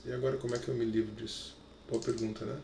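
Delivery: low, hushed aside; quick, quiet mumble to yourself while working something out; thinking aloud under the breath; talking to oneself. Real, not acted. Muttering to himself while fiddling with tool.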